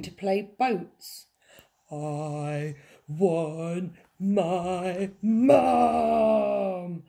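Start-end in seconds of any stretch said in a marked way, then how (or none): 1.9-7.0 very drawn-out loud wail; hooting wail. A narrator's reading. Princess playing boats with the Admiral, hooting. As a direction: hoot it out, stretching Mum. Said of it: "hooted the little princess".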